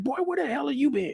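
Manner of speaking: high, soft scolding dad voice; a father's put-on voice demanding where his boy was; father scolding son. Father snaps at son who came home late, high scolding voice.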